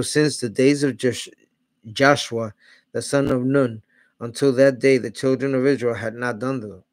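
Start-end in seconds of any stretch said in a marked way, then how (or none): none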